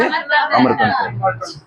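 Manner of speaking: slow, stretched high Amarkantak; drawn-out answer. Real, not acted. Teacher recapping the source, stretched and sing-song. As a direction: draw the answer out long and high.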